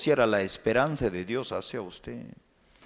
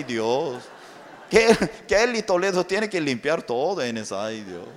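normal talking voice

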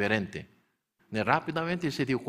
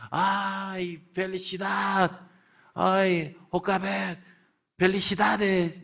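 high, bright cries of joy; joyful congratulations; 9 semitones higher, 4 dB louder than usual. Acted. Neighbours greeting Jocabet on her baby, joyful high cries. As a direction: cheer the new mother like a happy neighbour.

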